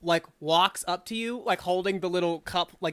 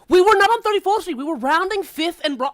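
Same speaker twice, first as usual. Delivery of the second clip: put-on high, loud screechy girl voices yelling over each other; two girls shrieking an argument at full volume; screechy girl bickering; 10 semitones higher, 9 dB louder than usual. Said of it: "loud bickering of high, like, screechy girl voices".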